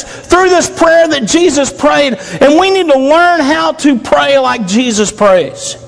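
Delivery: ordinary talk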